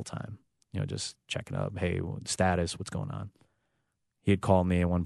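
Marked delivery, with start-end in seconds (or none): none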